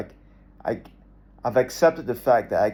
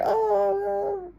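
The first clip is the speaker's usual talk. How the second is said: mock panicked scream. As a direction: ham up a dying man's panic.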